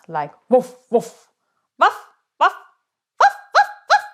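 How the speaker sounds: loud barks from the stomach, climbing very high; barking like a dog. Shown as a demonstration. Teacher barking like a dog to wake the call voice. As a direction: bark like a dog using the belly muscles. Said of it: "bark like a dog".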